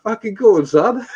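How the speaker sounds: high, bright mock English accent; high mock impression of the friend; imitating friend's accent. Retelling a friend's English accent in mock English accent. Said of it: "It's like".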